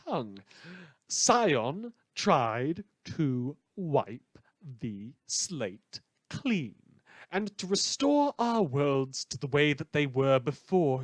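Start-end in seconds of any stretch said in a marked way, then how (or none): 1.1-6.8 slow, deliberate re-say of "wipe the slate clean"; slow careful retake after a slip; careful correction. Real, not acted. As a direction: spell out "wipe" clearly, word by word. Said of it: "tripping over my own tongue".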